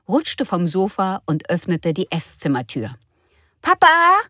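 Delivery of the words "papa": put-on high child's call across the room. Frieda calling her dad from the doorway, high and loud. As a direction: call Papa like a little girl. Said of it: "rief sie".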